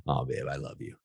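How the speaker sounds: soft, low, teasing affection; husband answers the complaint softly, tender; affectionate. Shown as a demonstration. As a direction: brush her off gently with love. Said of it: "I just laugh".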